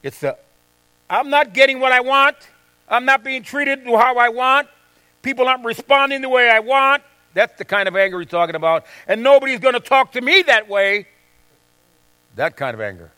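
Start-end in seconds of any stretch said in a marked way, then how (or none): 1.1-7.0 raised, whiny voice of a selfish complainer; selfish grumbler. Retold or imitated. Preacher voicing a selfish person who feels wronged. 9.1-11.1 raised, indignant mock outrage; indignant anger. Preacher acting out a proud man taking offense. Voicing angry person.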